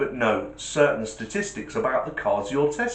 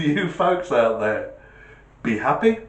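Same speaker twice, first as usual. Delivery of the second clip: ordinary talk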